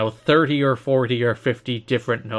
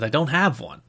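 usual speaking voice

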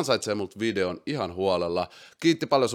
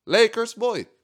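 excited; 13 semitones higher, 5 dB louder than usual. Real, not acted. Repeats win joyfully.

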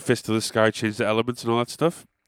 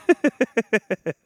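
laughing at own joke; a high burst of laughter; high laugh after a mock plea for praise; 10 semitones higher than usual. Real, not acted.

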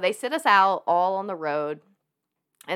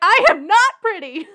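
much higher and louder, cracking up; laughing mock despair; 13 semitones higher, 11 dB louder than usual. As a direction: declare the disaster while laughing. Mirror reveal, laughing.

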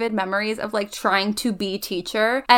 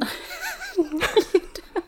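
laughing; 5 dB quieter than usual. Real, not acted. Laughing at own story.

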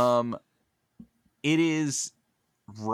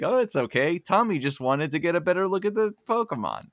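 put-on careless old man quote; 7 semitones higher, faster than usual. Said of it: "And he's just like".

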